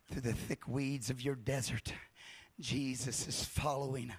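ordinary talk, nothing marked

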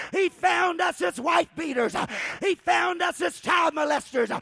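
shouted, rhythmic sermon delivery; fiery preaching. Acted. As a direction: preach it hard to the congregation. Preaching at full force.

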